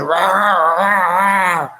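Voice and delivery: long imitated ape roar; gorilla roar. Storyteller imitating the big gorilla's charge, mock roar. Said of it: "when the big gorilla".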